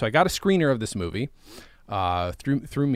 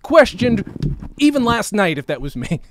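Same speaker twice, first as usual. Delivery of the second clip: excited; 6 semitones higher, 5 dB louder than usual. Real, not acted. Insecure laughing rant.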